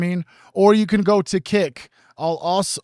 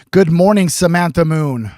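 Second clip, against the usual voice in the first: loud greeting; 7 dB louder than usual. Real, not acted. Greeting a viewer warmly.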